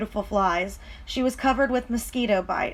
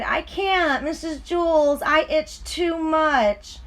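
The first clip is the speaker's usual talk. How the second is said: mild whine; 6 semitones higher, slower than usual. Acted. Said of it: "Dana whined".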